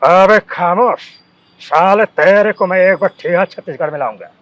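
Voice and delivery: loud, menacing threat to an enemy; angry threat. Retold or imitated. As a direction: bark the threat like a villain. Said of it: "ऐसे करके ना।".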